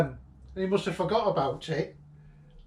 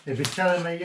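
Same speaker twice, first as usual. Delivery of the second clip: normal talking voice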